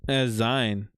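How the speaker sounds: "Zine" with a long eye vowel; "Zine" rhyming with sign. Shown as a demonstration. Streamer admits the old mistake, "Zine" as "zyne". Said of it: "always just saw it".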